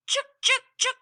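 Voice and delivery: high chattering squirrel scold; sharp high squirrel chuks. Acted. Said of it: "She chattered".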